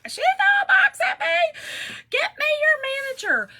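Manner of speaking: very high, loud mock screech; hammed-up shrieking complaint; elderly lady screaming. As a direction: imitate an outraged old lady screaming. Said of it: "it's an elderly lady screaming".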